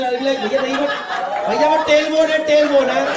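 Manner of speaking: घबराई आपत्ति. Retold or imitated. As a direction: yelp the correction in mock panic. Victim corrects gunman, protesting. (ऊँची आवाज़ में घबराकर)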